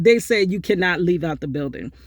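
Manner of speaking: normal talking voice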